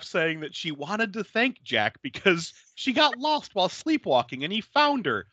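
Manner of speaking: ordinary talk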